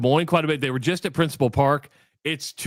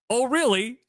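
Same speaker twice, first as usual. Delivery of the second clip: surprised; 9 semitones higher than usual. Retold or imitated. Hearing of shared hometown.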